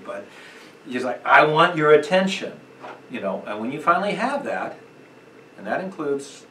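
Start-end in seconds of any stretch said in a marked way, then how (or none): 1.2-2.5 command voice. Shown as a demonstration. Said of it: "Use a command voice".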